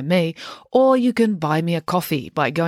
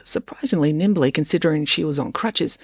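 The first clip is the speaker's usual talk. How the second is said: ordinary delivery, nothing marked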